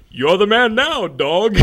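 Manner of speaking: high, loud hammed-up catchphrase; mock trailer catchphrase. Quoting a trailer catchphrase, hammed-up and loud. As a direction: belt it like a cheesy trailer line.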